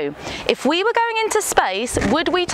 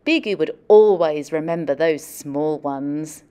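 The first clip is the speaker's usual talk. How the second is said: picture book story narration; storybook reading; 6 semitones lower than usual. Acted. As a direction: tell it gently to a class of kids. Reads picture book aloud.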